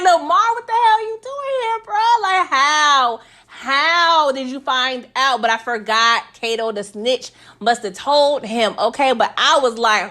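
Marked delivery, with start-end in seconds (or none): 0.0-5.4 high-pitched mock outraged disbelief; shocked disbelief. Viewer confronting Lamar at the food truck, shocked disbelief. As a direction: demand an answer, stunned, starting high and slowing down.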